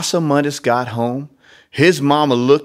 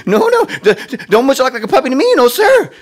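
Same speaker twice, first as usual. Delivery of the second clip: high, fast, laughing hyper critter voice; hyper Nutria; 13 semitones higher, 6 dB louder, faster than usual. Acted. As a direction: rattle it off giggling like a sugared-up nutria. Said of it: "Nutria should have a really hyper voice".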